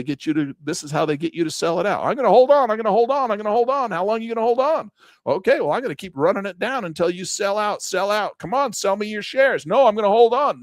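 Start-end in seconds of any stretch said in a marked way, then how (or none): 2.0-3.9 much higher, louder, insistent "I'm gonna hold on"; stubborn retail trader. Retold or imitated. 3.9-9.7 higher, pushy banker mock voice; taunting big bank. 9.7-10.6 higher, louder mock defiant "No"; stubborn refusal. Trader refuses again.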